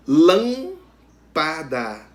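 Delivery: "Lâmpada" very slow, first syllable loud and long; teacher splitting "Lâmpada", strong first syllable; stress on LÂM. Shown as a demonstration. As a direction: punch "Lâm", then separate "pa" and "da". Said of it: "A sílaba tônica".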